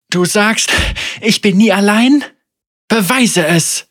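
loud, high challenging cry; defiant shout. A narrator's reading. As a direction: hurl the challenge at her. Senna defiantly challenges the spirit.

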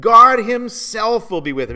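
"God himself" punched high and very loud; emphasis on God himself. Shown as a demonstration. Preacher points out the verse's emphasis, "God himself" forcefully stressed. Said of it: "Do you notice that emphasis?".

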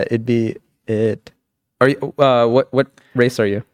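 ordinary delivery

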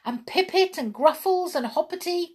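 high, eager cry of names; an excited little brownie crying out; cried out. A narrator's reading. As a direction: call them out with delight. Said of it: "cried Jump".

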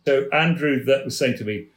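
ordinary talk, nothing marked

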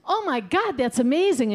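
high-pitched burst of acted joy; amazed delight. Cured wife hearing the doctor's good news, amazed delight. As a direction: react thrilled, pitch leaping up. Cured patient reacts in retold joke.

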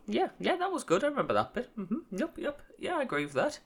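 nod along as if you remember; imitating a clueless, eager agreer; fake nodding agreement. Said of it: "just nodding your head and going".

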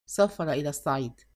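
low, heavy and glum, سافر dropping; a dull numbed reply, سافر said low and flat; understated gloom. A narrator's reading. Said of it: "فقالت بوجوم".

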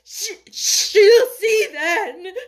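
slow, loud, high stammering sobs; gasping between sobs. A narrator's reading. Sobbing boy lets the nurse show Mary, gasping. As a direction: force the words out between sobs. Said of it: "gasped out between two sobs".

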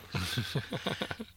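soft trailing chuckle; real laugh. Real, not acted. Guest chuckling at being called a story magnet, soft laugh. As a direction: let the laugh roll out and fade.

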